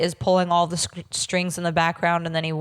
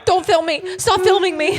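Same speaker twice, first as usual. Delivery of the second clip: mock hysterical; 10 semitones higher, 5 dB louder than usual. Mocking Angela's meltdown.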